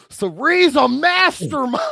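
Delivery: loud, slow, hammed-up awe; mock amazement, stretched out and shouted; Ozzy impression. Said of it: "Ozzy's confessional is like".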